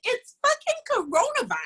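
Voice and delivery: incredulous inner thought. Retold or imitated. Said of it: "And I'm thinking".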